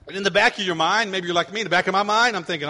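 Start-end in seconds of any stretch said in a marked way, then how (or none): none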